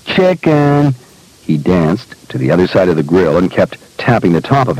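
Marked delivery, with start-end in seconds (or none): 0.1-0.9 put-on high, taunting child's call; sing-out teasing dare; gleeful child's cry. A narrator's reading. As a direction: cry it out high like an ecstatic boy. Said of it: "he cried to his dad".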